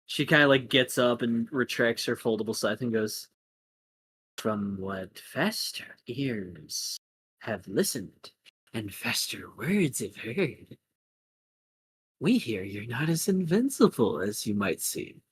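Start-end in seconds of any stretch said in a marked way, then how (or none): 4.4-15.2 slow, soft, ominous delivery; hushed, deliberate villain; menacing villainess. Acted. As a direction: speak slowly and softly with menace.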